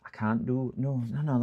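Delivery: ordinary talk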